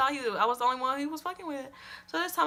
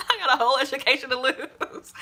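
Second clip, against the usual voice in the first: laughs through "whole education to lose"; laughing. Real, not acted. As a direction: laugh at her own recklessness. Laughing at herself.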